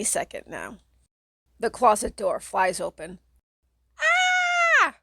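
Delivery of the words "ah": piercing, extremely high scream; terrified scream. Acted. Kate screaming at the monster in the closet, piercing high. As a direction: scream as the closet bursts open. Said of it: "Kate screams".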